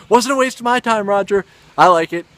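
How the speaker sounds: high, loud comeback; mock retort. Vlogger answering Roger Ebert's panning of a loved movie, mock retort.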